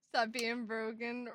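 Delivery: normal talking voice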